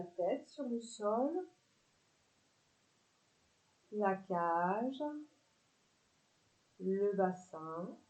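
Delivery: calm body-scan voice with long pauses; guided relaxation. Acted. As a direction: let each phrase settle before the next.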